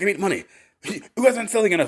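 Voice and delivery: high, fast, petulant complaint; whiny boss. Retold or imitated. Boss complaining to his staff, high whiny voice. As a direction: whine like a spoiled boss.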